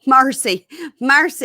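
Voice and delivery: loud high Mercy, mercy, mercy; mocking Dave. Husband mocking her accent, loud high Mercy. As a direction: ham up Mercy, mercy, mercy like a teasing husband. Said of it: "Dave said".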